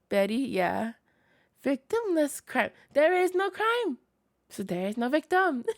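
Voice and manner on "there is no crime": mock defense.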